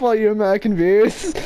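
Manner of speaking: ordinary talk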